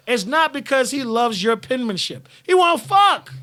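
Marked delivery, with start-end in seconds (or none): 2.4-3.3 loud, high-pitched blunt punchline; emphatic outburst. Real, not acted. Host hammering home what guys want, emphatic outburst. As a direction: drive the point home loudly, pitch jumping up.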